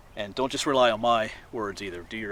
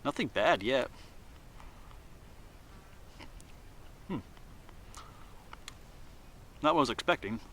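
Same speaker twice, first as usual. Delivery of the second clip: mouth full; 7 dB quieter than usual. Real, not acted. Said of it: "after it's in your mouth a little bit".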